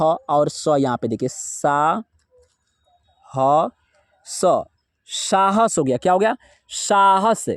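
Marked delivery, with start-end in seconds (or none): 1.3-4.6 slow, lower syllable-by-syllable breakdown; unhurried teacher spelling. Shown as a demonstration. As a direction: spell the word out part by part for the learner. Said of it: "कहाँ है सा?".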